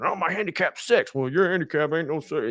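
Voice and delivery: two candidates squabbling, lightly hammed up; mock fired-up bickering. Man retelling candidates' golf argument, mock fired-up bickering. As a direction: imitate a petty back-and-forth boast and retort. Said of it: "fired up a little bit".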